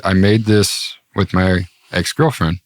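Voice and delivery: ordinary talk, nothing marked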